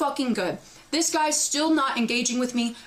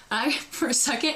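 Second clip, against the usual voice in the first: ordinary talk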